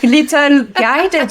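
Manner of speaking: French sexy voice. Shown as a demonstration. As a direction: ease into the French sexy voice, slowly. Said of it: "everybody love my French sexy voice".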